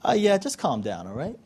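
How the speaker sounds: soft, low-key reassurance; laid-back and quiet; unbothered calm. Acted. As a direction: casually brush off the panic, trailing down low. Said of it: "many times he answers us in the same tone".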